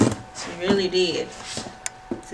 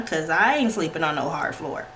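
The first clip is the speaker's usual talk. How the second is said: ordinary talk, nothing marked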